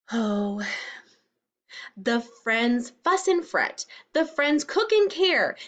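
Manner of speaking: ordinary delivery, nothing marked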